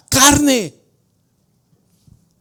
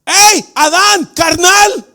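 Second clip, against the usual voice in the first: loud, high calling out to Adam; mock call to Adam; 5 semitones higher, 7 dB louder, slower than usual. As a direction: hail Adam across the yard. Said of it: "no le podíamos llamar a Adán".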